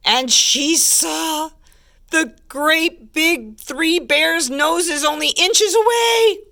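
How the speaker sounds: climbing storybook alarm; suspenseful build. A narrator's reading. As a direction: stretch out the big reveal.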